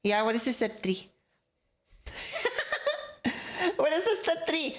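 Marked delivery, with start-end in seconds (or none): 2.0-3.7 high, soft burst of giggles; laughing. Real, not acted. Streamer cracking up at the advice, giggling. 3.8-4.8 higher-pitched, giggly question; amused. Real, not acted. Streamer asking for the missing step, amused. Asks again right after laughing.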